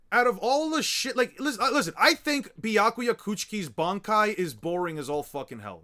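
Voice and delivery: loud, high, insistent opinion; real worked-up fan outburst; heated rant. Real, not acted. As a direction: insist loudly that they listen.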